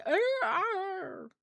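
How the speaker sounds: high, playful fake roar; mock lion roar. The lion not caring, letting out a mock roar. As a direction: toss off a silly little roar. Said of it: "The lion's just like".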